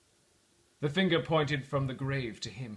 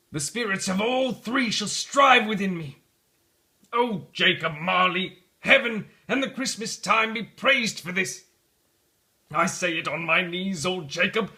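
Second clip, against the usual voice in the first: ecstatic vow; 6 semitones higher, 7 dB louder than usual. Acted. Scrooge praising Jacob Marley on his knees, ecstatic. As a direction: cry it out in joyful relief.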